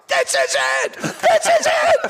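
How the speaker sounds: high-pitched, over-the-top mock yell; excited yelling. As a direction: yell it like a frenzied spectator. Retold frenzy at horse show.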